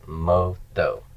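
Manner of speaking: teacher modelling moto for students; slow pronunciation demo. Shown as a demonstration. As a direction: say moto slowly, each syllable clear. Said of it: "so his death".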